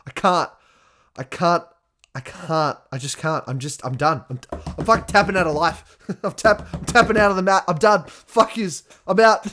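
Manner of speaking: mock desperate quitting. Retelling tapping out on the mat, loud hammed-up despair. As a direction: wail that you're done with everything. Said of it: "And I'm like".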